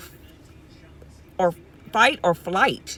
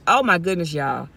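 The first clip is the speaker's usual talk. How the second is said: ordinary talk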